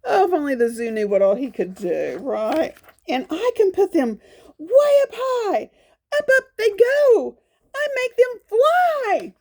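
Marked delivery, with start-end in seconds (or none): 3.1-9.3 higher, rising excited storybook leopard. Acted. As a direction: lift the voice up as the spots fly. Leopard lifts spots up high.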